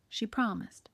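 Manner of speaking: ordinary delivery, nothing marked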